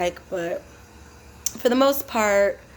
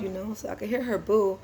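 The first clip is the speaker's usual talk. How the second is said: ordinary talk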